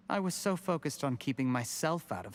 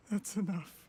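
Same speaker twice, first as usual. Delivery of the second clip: high, weakened plea to stop; defeated plea; 6 semitones higher than usual. Acted. Cornered man begs his accuser to stop, defeated. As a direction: let the protest fade, pitch still raised.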